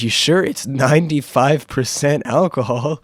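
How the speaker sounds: hammed-up guy warning; mock warning. Guy warning the speaker about strong vodka, mock alarm.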